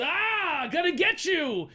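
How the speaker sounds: high, loud taunting yell; mock battle yell. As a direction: yell like a clumsy attacker charging in. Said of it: "and yells".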